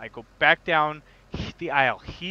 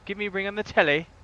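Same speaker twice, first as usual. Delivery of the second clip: higher, mock British accent; bad British accent; 6 semitones higher, faster than usual. Teasing a British player about phone words, mock British accent. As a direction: put on an amateur British accent. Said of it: "If you're gonna do a British accent".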